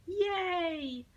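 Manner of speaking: high excited cheer; kids cheering. Acted. Children cheering as school closes early, excited.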